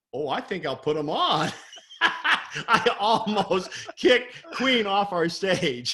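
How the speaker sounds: laughing through the words. Real, not acted. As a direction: laugh at your own mistake.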